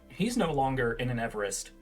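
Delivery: normal talking voice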